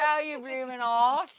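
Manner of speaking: goofy mock British accent; a silly English insult in a put-on British accent. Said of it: "talking in our British accent".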